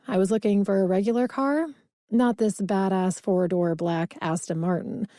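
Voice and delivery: usual speaking voice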